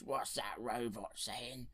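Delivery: slow, lightly voiced slug creature; a hint of a slow monster boss; Jabba the Hutt. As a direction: stretch it out like a big slimy alien. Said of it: "And he goes".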